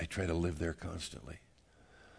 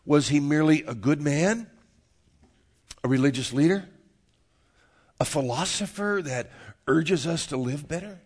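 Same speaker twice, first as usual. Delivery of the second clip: raised pitch, deliberate questions left hanging; rhetorical preaching; 5 semitones higher, 6 dB louder than usual. Real, not acted. Pastor asking the congregation who Jesus is, rhetorical preaching. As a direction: press each question on the listeners, pausing between.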